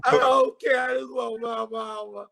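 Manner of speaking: high, wailing mock sob for mama; mock crying. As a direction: blubber like a grown man crying for his mother. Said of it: "he's crying all over the shit".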